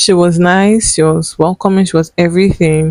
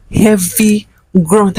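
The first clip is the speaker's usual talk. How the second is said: ordinary talk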